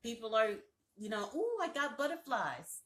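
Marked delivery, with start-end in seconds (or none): 1.3-2.8 lightly mimicked giddy feeling, higher voice; mock someone fluttery with butterflies; mock excitement. Mimicking people who trust their feelings.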